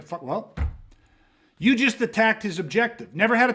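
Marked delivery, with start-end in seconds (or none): 0.0-0.5 deflated mutter. Retold or imitated. Deflated reaction after crashing car.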